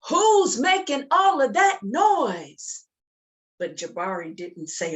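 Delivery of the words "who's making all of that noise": high, loud, slow demand; father shouting. Acted. Father bursting in on the noisy boy, shouting. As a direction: call up the stairs like an annoyed dad. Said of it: "what he said".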